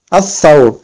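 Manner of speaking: Al-thawr with the l silent, th held; doubled th. Shown as a demonstration. Teacher repeating the correct reading, "Al-thawr" with double th. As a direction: lengthen the th of "Al-thawr" in place of the l. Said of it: "On ne dit pas".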